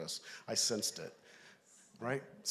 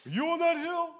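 shouted question; 13 semitones higher, 3 dB louder than usual. Retold or imitated. Calling up to son.

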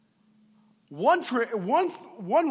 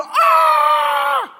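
sudden high shouted "Ah!" of terror; panicked scream; 12 dB louder than usual. Acted. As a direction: scream it like the boat is sinking. Disciples panicking in the storm.

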